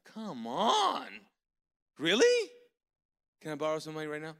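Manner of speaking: joking amazement, shooting up high and loud; stunned excitement. Retold or imitated. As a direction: yelp it like you just got free money. Reacting to an unexpected gift.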